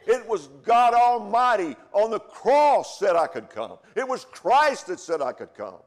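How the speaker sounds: building, loud, slowing on the cross; preaching at full force. Acted. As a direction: crescendo of a revival sermon. Preaching build to God Almighty.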